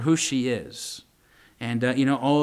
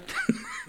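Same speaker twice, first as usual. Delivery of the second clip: real laugh; 7 dB quieter than usual. Real, not acted. Laughing at the memory.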